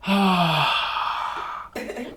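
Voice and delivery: slumped sigh dumping all the air; collapsing sigh. Shown as a demonstration. Teacher imitates a student emptying her lungs, heavy sigh. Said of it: "She went like this".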